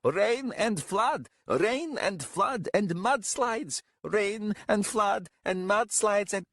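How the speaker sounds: high, slow caterwauling; wailing shout. A narrator's reading. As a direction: wail the growing list of disasters. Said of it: "shouted Tathanel".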